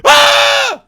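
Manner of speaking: loud high terrified scream. Retold or imitated. Retelling waking to a Samara-like shadow, loud scream. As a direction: shriek in sudden terror.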